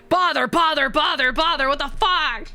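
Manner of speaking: high, punchy mock nagging; playful high-pitched badgering; mock pestering. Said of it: "I'll just be like".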